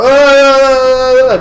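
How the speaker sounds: loud high mock yell of a tripping man; imitated yell. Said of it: "just like".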